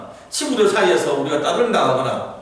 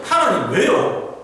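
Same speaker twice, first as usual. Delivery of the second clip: pleading complaint; 6 semitones higher, 3 dB louder, slower than usual. Retold or imitated. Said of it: "계속 그거 하는 거예요".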